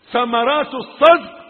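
high, very loud refrain; emphatic proclamation. Real, not acted. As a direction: throw the phrase out forcefully. Repeated phrase bursts loud. (إعلان جهوري مؤكد)

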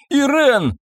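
high, urgent cry of a name; yelled call. A narrator's reading. Husband calls his wife from the stairs, yelling. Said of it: "он что-то вопил, как всегда задыхаясь".

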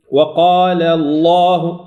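drawn-out «وقال الله» with a heavy, dark L; lam pesado. Shown as a demonstration. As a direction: chant الله slowly with a full velarized L. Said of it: "أفخم اللام".

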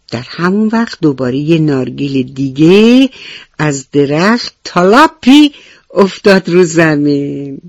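ordinary delivery, nothing marked

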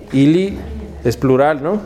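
normal talking voice

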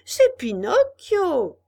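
crié fort, lent et haut; cri de Polichinelle. A narrator's reading. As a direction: appelle avec éclat. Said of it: "cria Polichinelle". (Polichinelle reconnaît Pinocchio et crie de joie)

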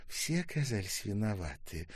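ordinary delivery

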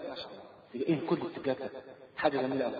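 hushed, breathless wonder at the carpets; awed whisper. Retold or imitated. Rib'i stepping onto the palace carpets, whispering in awe. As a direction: whisper it like you can't believe the luxury.

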